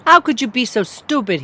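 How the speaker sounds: high, loud, scolding yell. Acted. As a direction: yell at her, afraid underneath. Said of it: "he yelled".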